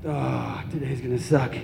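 low, soft, dejected sigh; weary groan. Acted. Waking up on Sunday morning, weary groan. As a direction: groan it softly, dreading the day.

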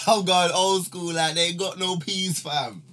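laughing into a hammed-up London street voice, slowed; mock London road slang. Teasing his bandmates with a put-on London street accent. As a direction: play the hard man from the ends for a joke.

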